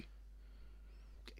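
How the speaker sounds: unsure whispered guess; whispering. Real, not acted. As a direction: whisper the name hesitantly.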